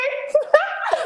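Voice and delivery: bursts of high laughter. Real, not acted. Cracking up while retelling the weigh-in, high laughter. Laughing at own retold outburst.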